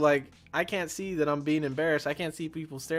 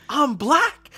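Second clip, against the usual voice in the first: much higher and louder, hammed-up shock; shocked disbelief; 13 semitones higher, 10 dB louder than usual. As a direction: squeal it like you just found out. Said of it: "he starts freaking out".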